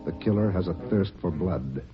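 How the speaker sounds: ordinary talk, nothing marked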